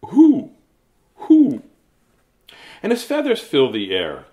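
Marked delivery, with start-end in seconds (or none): 0.0-1.6 high, loud owl hooting; owl hoots. Acted. Owl talking to you, loud high hooting. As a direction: hoot like an owl. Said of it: "his only word is".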